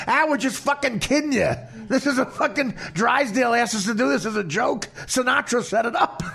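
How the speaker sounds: high, laughing mock reassurance; laughing prank reveal. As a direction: reveal the joke while cracking up. Retells prank reveal, cracking up.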